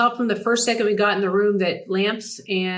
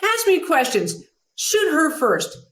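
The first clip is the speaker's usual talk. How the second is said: much higher, bossy impersonation; John McEnroe; 9 semitones higher than usual. Retold or imitated. McEnroe directing the camera crew, imperious. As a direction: bark mock orders at the crew. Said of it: "He's like".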